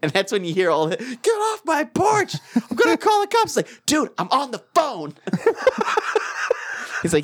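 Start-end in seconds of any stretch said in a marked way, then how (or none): 1.0-3.6 very high-pitched mock yell; squawking, strained imitation of yelling; retold yelling. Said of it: "yelling at him". 3.9-5.1 higher-pitched, whiny mock protest; put-upon retold complaint; retold exasperation. Drunk brother answers the yelling.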